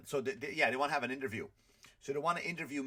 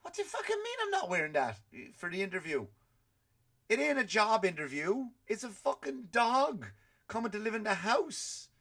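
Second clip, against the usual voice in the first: indignant outburst; 8 semitones higher than usual. Real, not acted. To his wife about the interview outfit, indignant outburst. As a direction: blow up at the idea of dressing up.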